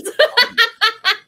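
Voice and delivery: big burst of laughter; loud laughter. Real, not acted. Group laughing fit.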